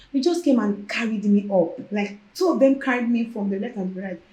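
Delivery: ordinary talk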